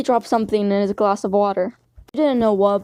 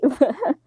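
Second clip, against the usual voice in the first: quick burst of laughter; a little laugh; short laugh; 5 semitones higher than usual. Acted. Laughing at choking Luffy.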